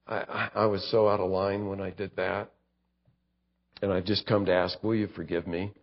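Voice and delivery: a believer apologising to a neighbor; humble apology. Pretend. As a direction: ask for forgiveness gently. Apologising to a coworker.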